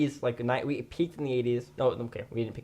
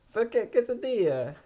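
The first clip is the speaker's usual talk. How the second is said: Mexican accent; 10 semitones higher than usual. Shown as a demonstration. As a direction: lean into a Mexican accent on quesadilla. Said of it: "show them your, uh, Mexican accent that you perfected".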